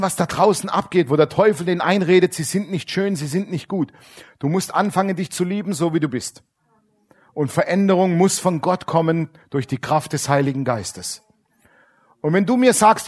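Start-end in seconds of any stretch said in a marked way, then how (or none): none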